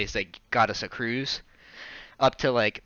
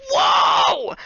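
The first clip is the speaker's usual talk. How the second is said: put-on high, breathy kid's wonder; imitating little brother amazed by the spigot; amazed whoa; 6 dB louder than usual. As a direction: mimic a kid in awe.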